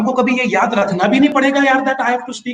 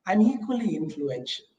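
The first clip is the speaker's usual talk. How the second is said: ordinary delivery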